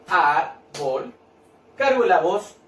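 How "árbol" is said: "árbol" slowly split as ár-bol; syllable by syllable. Shown as a demonstration. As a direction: break "árbol" into two drawn-out syllables. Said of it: "Yo, separo en sílabas".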